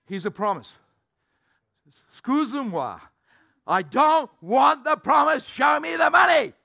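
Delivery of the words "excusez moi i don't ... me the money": loud, higher indignant demand; a hammed-up outraged yell for money; demanding shout. Demanding believer acted out.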